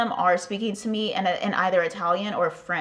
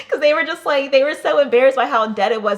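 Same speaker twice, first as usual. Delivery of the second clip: laughing; 6 semitones higher, 7 dB louder, faster than usual. Real, not acted. Laughing about apologizing bar staff.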